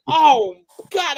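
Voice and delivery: high, loud mock recoil; disgusted shock. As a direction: gag on it, half laughing. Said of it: "and I'm like".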